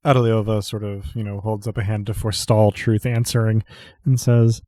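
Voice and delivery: ordinary delivery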